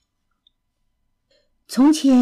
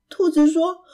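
plain reading voice

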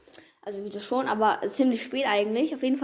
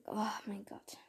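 breathless whispered awe; 3 semitones lower, 16 dB quieter than usual. Real, not acted. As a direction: whisper it in disbelief. Whispered exclamation.

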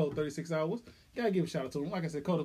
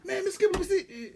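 higher-pitched mimicked child; excited kid; 12 semitones higher than usual. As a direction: imitate a kid begging to look. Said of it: "the kids ran to me".

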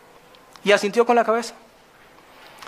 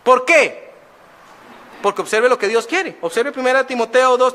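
ordinary talk

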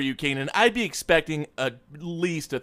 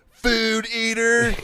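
slow, higher "food eater"; drawn-out quote; 6 semitones higher, much slower than usual. Retold or imitated. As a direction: savour each word. Savouring the insult.

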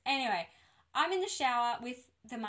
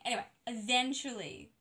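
normal talking voice

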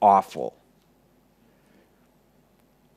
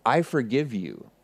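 soft, lower, solemn retelling; tender forgiveness; 4 semitones lower, 8 dB quieter than usual. Retold or imitated. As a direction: grant forgiveness quietly, after a long silence. Friend's forgiveness, solemnly retold.

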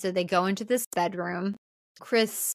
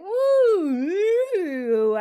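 loud, high ghostly "woo"; spooky woo; 10 semitones higher, 7 dB louder than usual. Retold or imitated. Nancy taunting Chris, loud spooky woo. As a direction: hoot it like a cackling witch.

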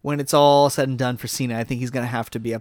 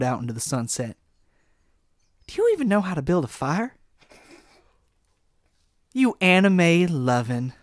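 Cowboy Kenny promo. Acted. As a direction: growl out a cowboy heel promo. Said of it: "I gotta get into character".

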